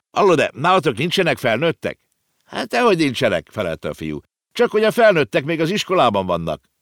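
brisk back-and-forth; lively children. A narrator's reading. Konrád and a boy talk in the street: lively children.

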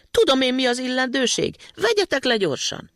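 put-on little boy insisting on getting down; insistent demand. A narrator's reading. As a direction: demand it again, high and firm. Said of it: "tiltakozott Matyi".

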